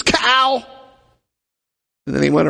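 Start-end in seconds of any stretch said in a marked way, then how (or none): 0.0-0.6 high, snapped insult; shouted insult back. Retold or imitated. Man yelling back at the woman, high mock shout. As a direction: fling it back at her. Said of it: "He goes".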